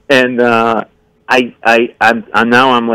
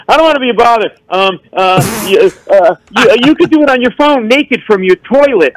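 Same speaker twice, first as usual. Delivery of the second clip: high nervous stammering; flustered babble; 10 semitones higher than usual. Retold or imitated. Nervous fan rambling to [name], high flustered babble. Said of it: "I actually said to [name]".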